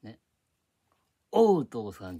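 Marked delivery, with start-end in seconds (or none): 1.3-2.2 "おう、父さん" with a loud English "oh" sound; wrong O pronunciation. Shown as a demonstration. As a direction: belt out "おう" like the English letter O. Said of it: "じゃなくて".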